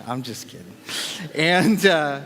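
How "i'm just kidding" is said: quiet afterthought repeat; softer trailing repeat. Shown as a demonstration. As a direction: drop it away, much softer.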